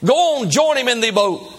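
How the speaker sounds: high, projected goading call. Acted. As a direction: call across the crowd to egg a friend on. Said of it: "he cries out and said".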